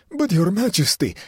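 high, loud wailing plea. Acted. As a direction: wail it out in despair. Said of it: "he wailed".

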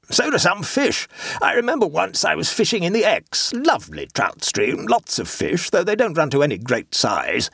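pompous clubman. A narrator's reading. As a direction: boom out the racing memories like a bore. Amblecope starting a fishing boast.

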